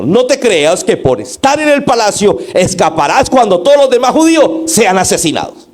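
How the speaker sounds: high, forceful rebuke in one driving run; urgent, reproachful warning read aloud; loud reproach. Shown as a demonstration. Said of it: "eso fue en el siguiente tono".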